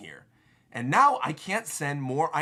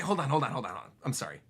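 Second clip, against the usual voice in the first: ordinary talk, nothing marked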